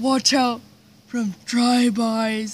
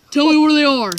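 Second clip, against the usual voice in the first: loud, higher-pitched interrogation; pretend threatening demand; 4 semitones higher, 7 dB louder, faster than usual. Gangster interrogates a captive about his friends, loud threat. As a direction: demand it forcefully, playing the tough guy.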